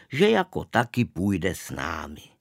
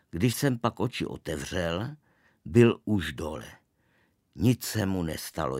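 usual speaking voice

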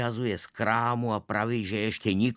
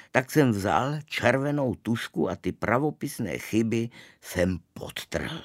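plain reading voice

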